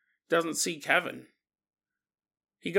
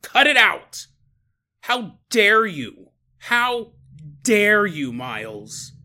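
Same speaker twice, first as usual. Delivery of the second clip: loud, raised acted outrage; mad outburst; 3 semitones higher, 6 dB louder, slower than usual. Tammy cutting off Miles's story, angrily. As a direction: play her snapping at a friend, loud and indignant. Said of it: "Tammy's getting so mad".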